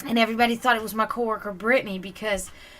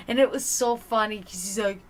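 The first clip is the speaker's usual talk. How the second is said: ordinary talk, nothing marked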